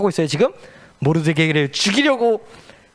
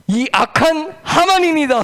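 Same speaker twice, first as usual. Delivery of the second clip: accusing queen; 10 semitones higher, 3 dB louder, slower than usual. Acted. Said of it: "손가락으로 가시면서".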